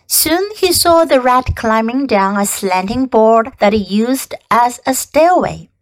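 plain reading voice

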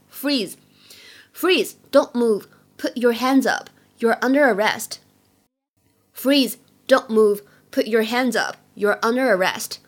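firm police officer's orders; police command. Acted. Police officer orders a bad guy to stop, firm command. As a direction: punch out each order, then pause. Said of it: "经常会看到警察叔叔对着坏蛋会说什么呢".

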